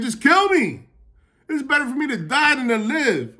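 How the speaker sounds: high, pleading cry to be let die; despairing Jonah. Acted. Jonah in the heat, begging God to end his life, high and pleading.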